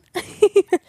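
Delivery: high, loud burst of laughter; bright amused laugh; real laugh. Real, not acted. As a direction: laugh out at the memory. Laughing at her own blunder.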